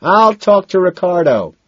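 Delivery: high, loud holler; loud call back; shouted assurance. A narrator's reading. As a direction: shout it over the club noise. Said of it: "Madison shouts".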